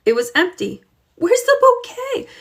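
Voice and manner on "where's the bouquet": put-on high, anxious girl's cry; a worried girl searching for something lost. Girl finds the bouquet missing, anxious high call. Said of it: "Josefina wondered anxiously".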